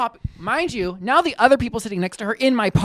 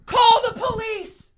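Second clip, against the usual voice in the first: very high, drawn-out imitated yell; mock screaming; 14 semitones higher, slower than usual. Old man to the restaurant, mock screaming for help. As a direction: wail it high and slow, hammed-up. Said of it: "this man screaming".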